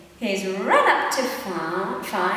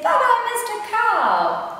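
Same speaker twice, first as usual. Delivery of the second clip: high, bright puppy greeting; little dog's greeting; 10 semitones higher than usual. Acted. Mum voicing the little dog for a toddler, high and eager. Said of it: "So he says".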